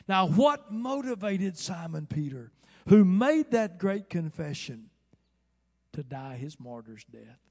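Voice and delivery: loud, high, slowed pulpit question; full-force preacher's rhetoric; impassioned preaching. Real, not acted. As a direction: thunder the question to the congregation.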